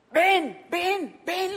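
high, insistent repeated call. Acted. The Lord calling Peter onto the water, insistent. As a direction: call out each word high and separate.

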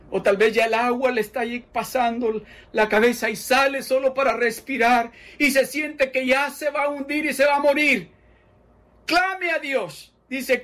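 high, loud altar call; passionate preaching. Acted. Preacher calling sinking listeners to cry out to God. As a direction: urge them to cry out to God.

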